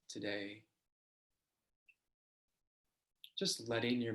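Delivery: slow, unhurried body-scan guidance; guided meditation. Acted. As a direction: lead them gently into the practice, leaving long pauses.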